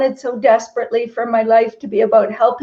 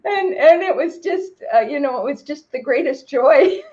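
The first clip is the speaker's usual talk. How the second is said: real crying through the words; tearful joy; 3 semitones higher than usual. Real, not acted. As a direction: voice breaking with tears of joy. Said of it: "feel, uh, quite emotional".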